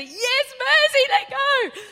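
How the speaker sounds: high, loud, slow yell to let go; pleading yell. Retold or imitated. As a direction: beg to be released, shouting. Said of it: "You saying mercy?".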